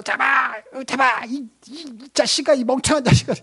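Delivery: high barked commands to a son; hammed-up furious father yelling at his boy; mock angry command. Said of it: "아들에게 명령적으로".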